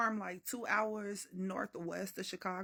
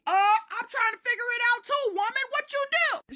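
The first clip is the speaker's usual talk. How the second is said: high, loud accusing outburst; acted panicked yelling; 13 semitones higher, 9 dB louder than usual. Naked narrator accusing granny, frantic yelling. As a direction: yell it in frantic confusion.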